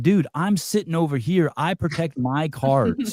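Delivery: retelling his outrage at his son, lightly exasperated; mock exasperation. Dad protesting to his eight-year-old over cards, mock exasperated. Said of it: "I'm like".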